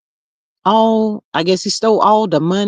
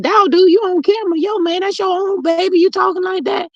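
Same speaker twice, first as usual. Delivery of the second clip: higher, hammed-up telling off; scolding a man; 11 semitones higher than usual. Talking to the rapper about his own baby, mock disbelief. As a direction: call him out like a disbelieving friend. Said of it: "I be like".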